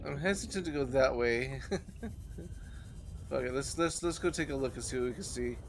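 usual speaking voice

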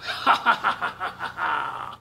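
loud, booming beast's chuckle; monster laugh. Acted. Gruffalo mocks the mouse, loud booming laugh. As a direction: laugh it off like a big brute.

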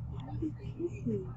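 inner thought. A narrator's reading. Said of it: "I thought".

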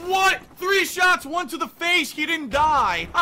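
high, very loud outrage; real exasperated shouting; incredulous yell. Real, not acted. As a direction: shout it in disbelief, breaking toward laughter. Disbelief at enemy surviving.

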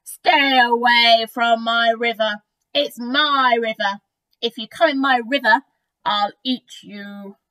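loud, drawn-out crocodile warning; bellowed threat, easing off at the end; menacing shout. A narrator's reading. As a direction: shout slowly and possessively at intruders. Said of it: "he shouted to the creatures".

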